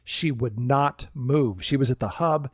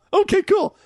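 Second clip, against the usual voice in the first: much higher, louder, chirpy okay cool; squeaky okay; 5 dB louder than usual. Retold or imitated. Skipper meekly agreeing to coworker, squeaky high okay. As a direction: squeak it out in mock meek agreement. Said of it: "And I'm like".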